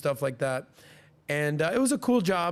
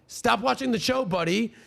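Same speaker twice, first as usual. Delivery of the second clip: higher, louder mock order at the busboy; retold yelling; 8 semitones higher, 4 dB louder than usual. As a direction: bark it like a boss catching him slacking. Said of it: "I got yelled at four times".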